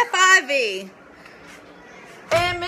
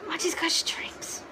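whisper; 3 semitones higher, 8 dB quieter, slower than usual. Real, not acted. Teasing cat with strings.

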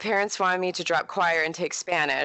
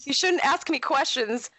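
sassy retort; 8 semitones higher than usual. Retold or imitated. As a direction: toss off the comeback with mock sass. Retells her sassy reply.

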